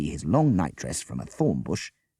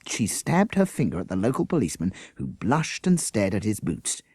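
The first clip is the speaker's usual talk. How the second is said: usual speaking voice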